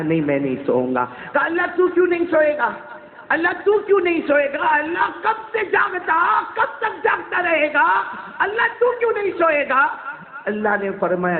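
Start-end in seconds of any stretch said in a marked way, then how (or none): 0.0-1.1 low, settled answer; calm refusal. Acted. God answers Moses' question, calm and low. 1.4-9.9 high, stretched, crying-out question; impassioned plea. Acted. Moses demands of God why, impassioned.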